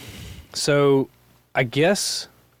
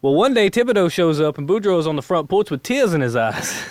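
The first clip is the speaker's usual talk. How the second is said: high, animated Cajun storyteller; put-on Louisiana Cajun joke-telling voice; Cajun accent; 6 semitones higher, 3 dB louder than usual. Shown as a demonstration. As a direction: tell it in a thick Cajun accent. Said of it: "do my best Cajun accent".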